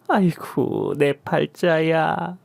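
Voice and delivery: put-on slow, drawn-out old woman's wail; wailing lament. Mother-in-law bemoans her fate after the quarrel, slow wail.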